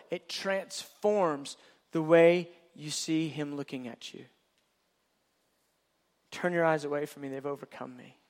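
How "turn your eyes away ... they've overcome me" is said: soft, hushed plea; tender. Acted. Jesus to his bride, tender and hushed. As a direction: let it fade tenderly.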